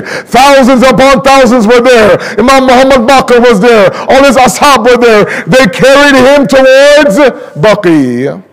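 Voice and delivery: rising mournful recitation; mournful lament recitation. Acted. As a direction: pull the listeners into grief.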